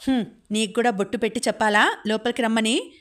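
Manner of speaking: usual speaking voice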